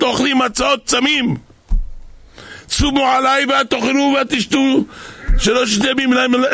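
high-pitched, insistent decree; emphatic proclamation. Acted. Esther and Mordechai declare a fast, emphatic proclamation. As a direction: cry out each clause, pitched high.

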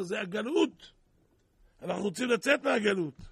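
loud, forceful sermon delivery; preaching. Acted. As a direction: hammer the moral home. Preacher drives home the lesson.

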